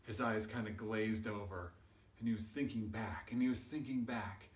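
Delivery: ordinary delivery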